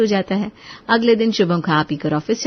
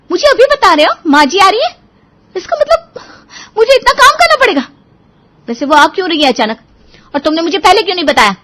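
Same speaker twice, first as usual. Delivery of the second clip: high, loud dismayed wife; whiny, flustered protest; alarmed surprise; 11 semitones higher, 10 dB louder than usual. Acted. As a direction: panic at the sudden news, ending in quick questions. Wife shocked by visit news.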